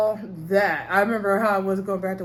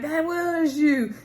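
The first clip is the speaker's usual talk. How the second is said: high, slow, drawn-out accusation; mock gasp of recognition; stunned realization; 7 semitones higher, much slower than usual. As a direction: stretch it out like you've just caught her. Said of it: "they're like".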